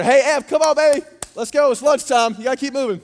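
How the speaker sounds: loud, high, hurrying parent; rally a kid off the floor; calling out to child. Retold or imitated. Dad calling daughter up off floor.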